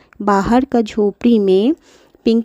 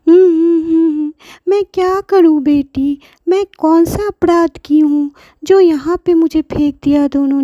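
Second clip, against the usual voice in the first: put-on higher, sobbing hums of an old woman; grandmother crying; 8 semitones higher, 3 dB louder than usual. Abandoned grandmother weeps in the hut, acted sobbing. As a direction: sob it out in acted grief. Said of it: "दादी बहुत रोने लगी".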